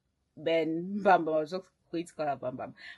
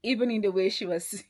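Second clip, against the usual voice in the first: ordinary delivery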